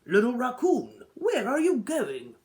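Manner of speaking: a father calling after his son. A narrator's reading. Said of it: "his father called".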